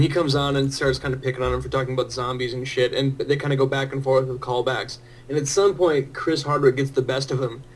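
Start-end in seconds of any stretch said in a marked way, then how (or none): none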